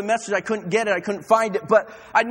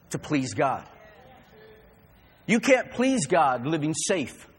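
normal talking voice

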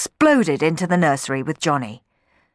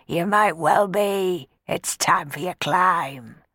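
sneering bully; 2 semitones higher, slower than usual. Acted. Bob keeps taunting Sammy.

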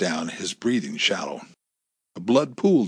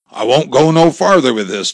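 defiant; 5 semitones higher, 10 dB louder than usual. A narrator's reading. Cowboy refusing, voiced loud.

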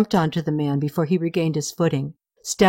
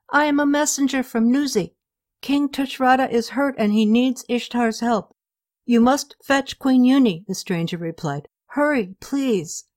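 lightly higher voiced messenger, urgent; a hint of a pressing stranger at the door; 7 semitones higher than usual. Stranger urging the sentry at night, lightly urgent.

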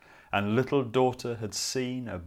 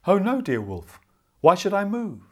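put-on high, loud innocent girl; little girl voice; 8 semitones higher, 9 dB louder than usual. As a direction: play the sweet innocent child. Girl answers the wolf innocently.

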